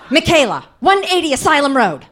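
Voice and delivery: yelled out, loud and high; yelling addresses. Retold or imitated. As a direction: bark the address like a frantic aunt. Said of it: "yell out our addresses".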